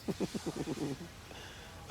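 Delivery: long, breathy run of giggles; soft chuckle. Real, not acted. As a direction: snicker quietly at your own joke. Laughs at own crude joke.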